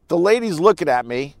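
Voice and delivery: ordinary delivery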